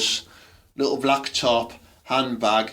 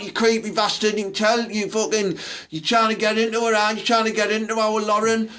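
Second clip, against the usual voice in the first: higher, hammed-up accusing girl; mock accusing daughter; 9 semitones higher than usual. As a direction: ham up a protective daughter. Daughter accusing him in bar.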